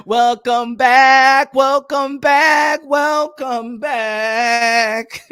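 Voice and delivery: very slow, stretched announcer welcome; slow mock announcer; drawn-out welcome. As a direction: draw each word out like a host.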